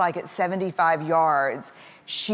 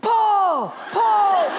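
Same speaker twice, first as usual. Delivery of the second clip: loud, high, drawn-out cheer; cheering yell; 6 dB louder than usual. Retold or imitated. Parents cheering their daughter like Olympic hopefuls, yelling. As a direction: yell the stroke from poolside at full voice. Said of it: "we were like Mama and Papa Phelps".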